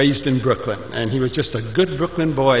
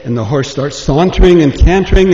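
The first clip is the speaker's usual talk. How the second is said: ordinary delivery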